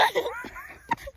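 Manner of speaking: high squealing laugh; shrieking laugh. Real, not acted. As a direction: let out a shrill cackle.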